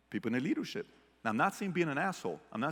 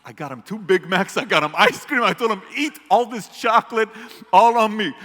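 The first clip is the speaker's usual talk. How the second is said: much louder and higher, gleeful bragging; excited dad; 7 semitones higher, 11 dB louder than usual. Retold or imitated. Dad telling son about spoiling grandson, loud and gleeful. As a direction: crow over the treat like a proud grandpa.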